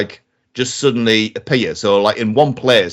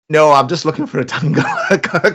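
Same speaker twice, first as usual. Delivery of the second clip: sheepish laughing reply; 5 semitones higher than usual. Retold or imitated. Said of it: "And I'm just like".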